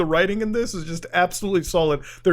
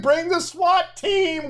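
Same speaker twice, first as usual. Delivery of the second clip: mock shout; 10 semitones higher, much slower than usual. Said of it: "Cops were like".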